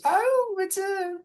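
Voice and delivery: put-on a fluttery, slightly senile old matriarch; old gnome woman. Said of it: "the old gnome woman goes".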